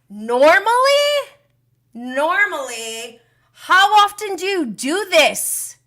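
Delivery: high, loud, drawn-out incredulous questions; incredulous disbelief. Real, not acted. Vlogger mocking Tom's excuse, in loud disbelief. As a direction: repeat the word like you can't believe it.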